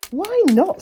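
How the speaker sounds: high, loud cross sister snapping; a sister woken and annoyed. Acted. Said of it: "who was a bit cross".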